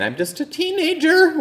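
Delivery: put-on teen voice breaking up high; voice cracking. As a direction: crack into a squeaky high pitch. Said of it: "with a voice cracking".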